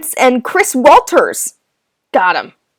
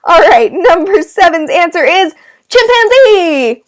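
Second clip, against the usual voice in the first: ordinary delivery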